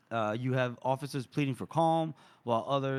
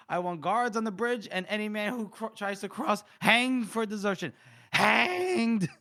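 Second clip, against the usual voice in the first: bursting with rage; 7 semitones higher than usual. Acted. Reader voices Mitterick threatening deserters, bursting with rage. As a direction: act an irate general barking orders. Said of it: "bursting with rage".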